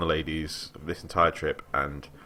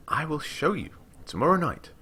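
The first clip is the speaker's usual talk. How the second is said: put-on higher, eager young woman's voice; a young scholar boasting to a traveller, lightly voiced; lightly voiced eager girl; 10 semitones higher than usual. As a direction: read her up high and keen, lightly. Girl's voice again.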